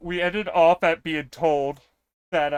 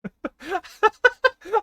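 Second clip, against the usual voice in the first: high-pitched burst of laughter; high laughing fit; 5 dB louder than usual. Real, not acted. Streamer about to confess to chat, high laughter. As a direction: crack up before telling the story. Laughs before telling on self.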